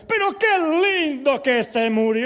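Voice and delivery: loud, drawn-out mock celebration at a funeral; hammed-up booming delight; imitated jubilant pastor. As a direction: crow it high and slow, stretching every word. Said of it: "pero siempre entraba y decía".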